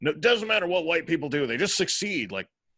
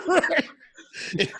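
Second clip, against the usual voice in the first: laughing; 14 semitones higher, 4 dB louder than usual. Real, not acted. Co-host cracks up at story.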